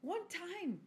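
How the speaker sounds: high, insistent self-defence; indignant protest. Retold or imitated. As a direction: protest it as a wronged mother. Defending herself against kids' teasing.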